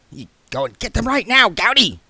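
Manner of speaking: snapping. Acted. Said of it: "snapped Bodney".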